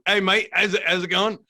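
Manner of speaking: put-on [name]'s English accent. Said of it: "he's got that accent in the voice too".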